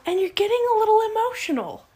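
lightly imitated prodding, high and quick; high-pitched probing imitation; mock-concerned questioning. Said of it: "and is like".

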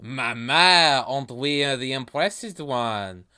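put-on talking banana with a thick French accent. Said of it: "focused on this accent".

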